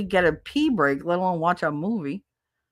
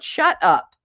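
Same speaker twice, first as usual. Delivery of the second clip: normal talking voice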